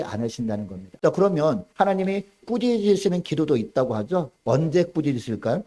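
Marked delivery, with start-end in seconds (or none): none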